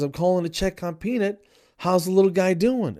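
mock-perky, high-pitched clinic caller; enthusiastic. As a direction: imitate an oblivious, eager check-in call. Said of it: "all enthusiastic".

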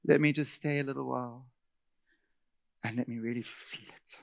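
soft self-pitying whine; lightly voiced sulker, quiet; mock wallowing. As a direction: linger in it, sorry for yourself. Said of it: "You're just going".

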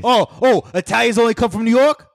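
high, loud, offended Boston Italian act; mock Boston Italian accent. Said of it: "I'm an Italian Boston".

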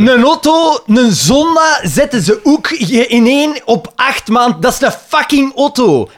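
heated disbelief. Real, not acted.

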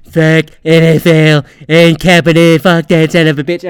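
a loud, hammed-up Trump rant shouted out; imitating Trump yelling. Trump yelling at Goodell, mock shouting. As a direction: bark it like an angry Trump. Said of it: "the Trump's yelling at him".

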